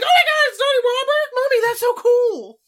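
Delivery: put-on high excited kid voice; excited little boy. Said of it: "Brody would be like".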